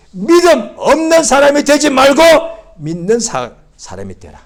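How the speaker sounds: loud, high command, dropping at the end; Jesus commanding. Retold or imitated. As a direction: give the order with authority. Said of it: "예수께서 도마에게".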